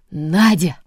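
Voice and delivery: loud urgent call down the phone. Acted. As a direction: wake the house with a call. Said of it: "подняла ... дом на уши Ба".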